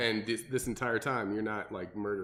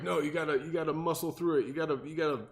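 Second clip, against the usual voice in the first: mock pep talk, pitched up and insistent; gung-ho crowd; 6 semitones higher than usual. Coach mimics everyone pushing hard workouts, mock gung-ho. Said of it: "everyone's like".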